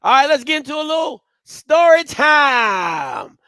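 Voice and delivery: loud, high announcer shout stretched out slowly; hype segment intro. Acted. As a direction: bellow the show intro, drawing out the name. Host bellows the segment intro.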